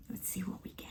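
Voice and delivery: hushed whisper before the reading; quiet whisper to herself. Real, not acted. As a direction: whisper as the cards are drawn. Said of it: "unvoiced whisper".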